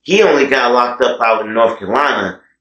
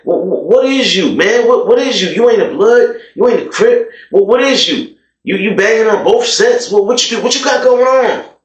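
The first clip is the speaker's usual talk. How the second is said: incredulous questioning; 10 semitones higher than usual. Retold or imitated. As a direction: fire off baffled questions at him. Crowd questioning the braggart.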